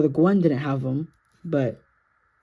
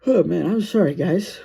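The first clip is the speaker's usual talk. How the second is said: ordinary talk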